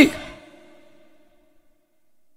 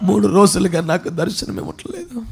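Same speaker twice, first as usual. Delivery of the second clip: sudden drop to a low, hushed lament; hushed, bereft confession; acted grief; 7 semitones lower, 9 dB quieter than usual. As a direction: grieve softly, as if losing hope. Acted Mary grieving her missing Lord.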